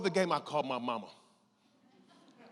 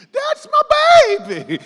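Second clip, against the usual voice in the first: high, loud proud scream; mama's scream; 20 dB louder than usual. Retold or imitated. Mama in the stadium screaming proudly for her son. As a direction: scream it from the stands. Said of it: "I hear my mama scream".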